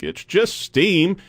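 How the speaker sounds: high, drawn-out mimicked excuse; mock protest. Host mimicking employees defending indoor vaping, mock protest. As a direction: stretch it out as an imitated protest.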